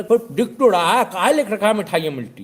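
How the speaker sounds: loud pushy heckler; comic shout. Retold or imitated. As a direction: grill him loudly. Know-it-all grills shopkeeper.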